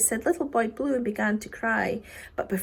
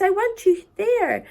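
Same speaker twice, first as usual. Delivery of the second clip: raised, high command to the clouds; 8 semitones higher, 5 dB louder than usual. Acted. Boy ordering the clouds down, raised command.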